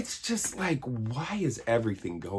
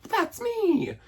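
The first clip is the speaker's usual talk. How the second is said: put-on very high, squeaky kid's cry; Billy's voice; 4 dB louder than usual. Billy claiming the present, very high and squeaky. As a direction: pipe it up like an overjoyed little boy. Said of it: "And then Billy's like".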